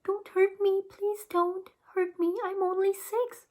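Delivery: high, mock-tearful little boy pleading; crying. A narrator's reading. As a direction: whimper it out like a scared six-year-old, high. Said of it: "Daniel started to cry".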